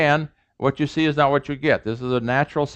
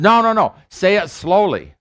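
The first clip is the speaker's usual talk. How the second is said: higher, louder mock protest; objecting learner; 6 semitones higher, 5 dB louder than usual. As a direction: cut in insistently to demand a redo. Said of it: "The other says".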